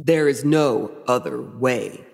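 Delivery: low, slow, final villain answer; solemn stagey devil; [name] Lucifer. Acted. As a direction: intone it gravely, drawing out each word. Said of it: "And Lucifer says".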